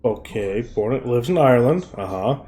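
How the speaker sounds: soft, low, dawning realization; slow dawning realization. Acted. Acting people slowly realizing, soft and low dawning dread. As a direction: mutter it as the penny drops. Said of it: "they slowly start putting two and two together".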